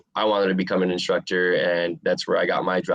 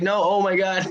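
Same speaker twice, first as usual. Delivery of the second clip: much higher mock exasperation; exasperated coach; 12 semitones higher than usual. Coach despairing at fighter's meal, high exasperated mock voice. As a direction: sigh it out in disbelief.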